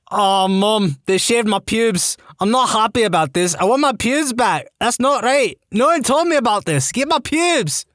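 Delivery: high-pitched, indignant Scottish wail; broad Scottish accent. Acted. Patient complains to mum, shouting in broad Scottish accent. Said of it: "I'm shouting in my broad Scottish accent".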